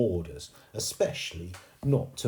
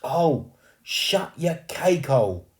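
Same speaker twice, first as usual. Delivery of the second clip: put-on loud, high cockney boy yelling; annoyed cockney ghost boy; rough boy ghost; 8 semitones higher, 9 dB louder, slower than usual. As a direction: shout at her to stop. Ghost boy telling girl off.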